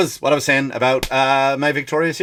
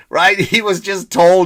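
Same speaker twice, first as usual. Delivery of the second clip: ordinary talk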